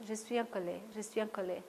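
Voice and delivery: soft, low-key repetition of the anger phrase; quiet repeated anger phrase. Shown as a demonstration. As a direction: murmur the phrase twice, barely voiced. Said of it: "insister sur cette colère".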